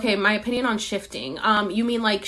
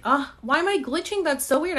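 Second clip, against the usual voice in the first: surprised; 7 semitones higher than usual. Real, not acted. Stream glitches mid-sentence.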